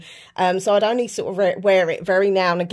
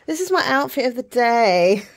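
slow, high, louder presenter voice; playful YouTuber showing off, drawn out; proper YouTuber; 5 semitones higher, 5 dB louder than usual. Pretend. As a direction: announce it like a YouTuber. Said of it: "I feel like a proper YouTuber now".

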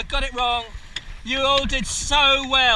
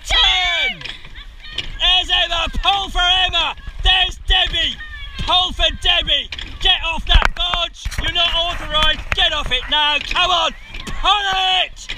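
race coxing calls; 7 semitones higher, slower than usual. Real, not acted. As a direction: push the crew hard, shouting each stroke. Cox calling rowing race.

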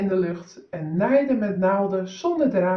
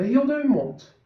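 ordinary delivery

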